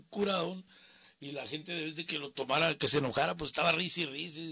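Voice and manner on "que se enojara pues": usual speaking voice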